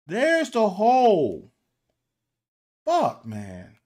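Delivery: slow, loud and high disbelief; fed-up coach yelling at the screen; exasperated shout. Real, not acted. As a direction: shout it at the replay. Exasperated pointing at open hole.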